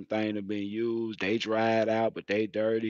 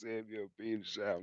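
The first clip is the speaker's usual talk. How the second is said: much softer, fast throwaway; quick soft repeat; 11 dB quieter, much faster than usual. Retold or imitated. Repeating mama's question, much softer and fast. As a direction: rattle it off under your breath. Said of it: "It was".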